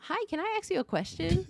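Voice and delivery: put-on high-pitched little girl asking eagerly; daughter's child voice. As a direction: imitate a small child's high, questioning voice. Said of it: "our daughter goes".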